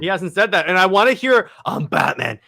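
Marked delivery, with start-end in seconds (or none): 1.6-2.4 lower, gravelly-free disguised growl; deep distorted Batman voice; voice changer Batman. Retold or imitated. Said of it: "in the voice changer voice".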